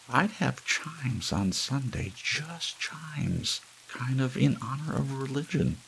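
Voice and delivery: sing-song the chimes idea; high simple-minded Hazel; Hazel's voice. A narrator's reading.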